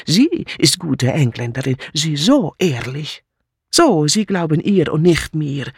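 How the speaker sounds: agitated refugee maid. Acted. Mitzi insisting to the inspector, agitated and defiant. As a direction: pour out the accusation, wounded. Mitzi accuses, broken German.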